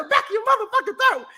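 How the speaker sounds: high, quick imitation of her instructing; animated bossy retelling; Shirley. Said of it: "That's how she said".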